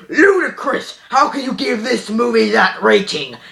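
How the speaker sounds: lightly put-on voice of an indignant viewer; mock outraged objector. Reviewer voicing people who object to the rating, mock outrage. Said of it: "some people probably say".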